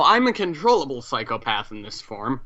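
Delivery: ordinary delivery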